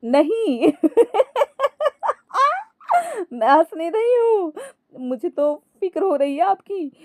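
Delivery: high-pitched denial through giggles; laughing. A narrator's reading. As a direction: protest while bursting with laughter. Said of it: "Ankita हंसते हुए ही बोली". (हंसते हुए)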